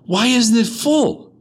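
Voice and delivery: loud, high, drawn-out demand; acted high shout, stretched with amazement; astonished drunk. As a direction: cry it out slowly in mock disbelief. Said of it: "told you about the drunk".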